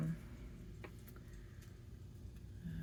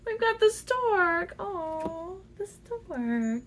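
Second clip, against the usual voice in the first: delighted; 10 semitones higher, much slower than usual. Real, not acted. Delighted at a card.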